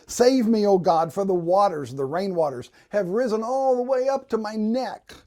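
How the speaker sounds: loud, urgent plea from the first word; impassioned scripture reading. Acted. Preacher reading a psalm about rising waters, urgent plea. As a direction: read it as a cry to God. Reads psalms aloud as pleas.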